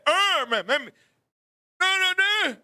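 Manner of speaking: high, loud mock wail; imitated deaf man's protest. Deaf Bear rejecting Barbara's answer, imitated wail. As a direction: wail half-formed words in mimicry. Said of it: "this guy is deaf".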